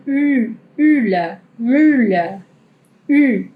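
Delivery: "mula" with rounded lips saying i, like myla; "u" held with U lips but an I sound. Shown as a demonstration. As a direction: demo the German ü in "mula". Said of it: "Yo estoy haciendo boca de U".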